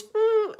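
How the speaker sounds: high-pitched hummed elephant call; imitated elephant noise. Showing how the wee boy does an elephant, high hum. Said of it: "like that for an elephant".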